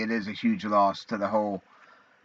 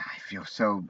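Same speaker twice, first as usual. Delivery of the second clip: ordinary talk, nothing marked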